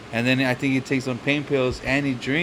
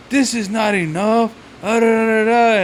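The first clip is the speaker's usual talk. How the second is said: whiny complaint; 7 semitones higher than usual. Retold or imitated. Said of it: "And he's like".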